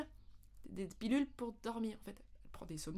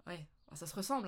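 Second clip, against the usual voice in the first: normal talking voice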